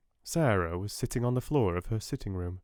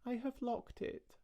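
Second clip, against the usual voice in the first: put-on high, soft, prim little girl; small polite voice through a door; a child quietly refusing, very high and hushed; queer polite little voice; 11 dB quieter than usual. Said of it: "said a queer, polite little voice".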